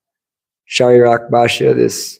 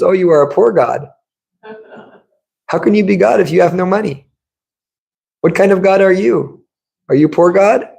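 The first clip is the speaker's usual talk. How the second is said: higher, playfully needling; mock trolling; 7 semitones higher than usual. Devotee trolling the girl about being a poor God, mocking higher voice. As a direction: poke fun at her, pitched up. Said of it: "[name] was really trolling her".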